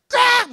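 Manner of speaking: sharp high loud crack; imitated smack. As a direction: snap out the hit sound. Sound of hitting sheep. (때리는 소리 흉내, 높고 날카롭게)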